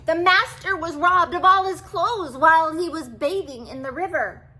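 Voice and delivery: high, loud pleading cat voice; a small cat begging the king for help. A narrator's reading. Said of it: "begged for help".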